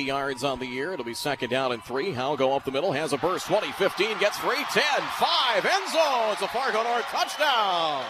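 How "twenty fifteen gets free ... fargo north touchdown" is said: touchdown call. Real, not acted. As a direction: yell the touchdown home, then hold it high. Touchdown run called live.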